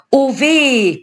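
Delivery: high, woeful witch cry; keening, drawn-out lament; wailing witches. A narrator's reading. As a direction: wail it like a lamenting crone. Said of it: "klagten die anderen beiden Hexen".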